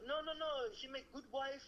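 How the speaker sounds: imitating an insistent foreign father; pushy father voice. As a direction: push hard, like a father selling a match. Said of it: "he was not taking no".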